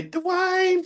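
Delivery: high, drawn-out Southern belle "Dwayne"; a high Southern belle "Dwayne" in Whitley's manner; Whitley Gilbert accent. Retold or imitated.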